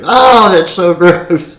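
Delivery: put-on high, loud and drawn-out kid's disgust; little niece grossed out. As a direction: play the little niece tasting the sauce, slowly and grossed out. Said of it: "stick her little finger in the sauce and go".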